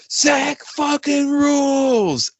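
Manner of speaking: stretched high mock yell from the control room; imitated yell. Producer yelling to the guitarist, imitated in a long high yell. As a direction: call it out long and high like across a studio. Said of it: "Andrew yelled from the fucking control room".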